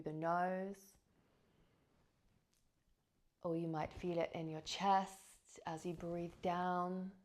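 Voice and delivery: measured, spacious breath-practice narration; guided meditation. Acted. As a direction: guide listeners gently into the breath, leaving space between phrases.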